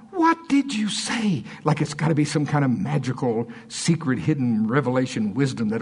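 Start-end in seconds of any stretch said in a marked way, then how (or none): 0.1-1.4 high, drawn-out pleading question; slow, high-pitched mock astonishment; desperate curiosity. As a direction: imitate awed onlookers begging to know. Onlookers begging Dave to tell.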